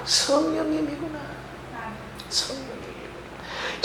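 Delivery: hushed realization. Retold or imitated. Retells hushed inner realization.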